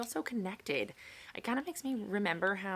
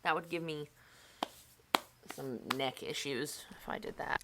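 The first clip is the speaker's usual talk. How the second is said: ordinary talk, nothing marked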